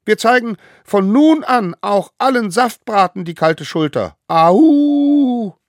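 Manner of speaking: high, loud solemn call; leading an oath. A narrator's reading. Smallest one calling the oath. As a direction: proclaim each word.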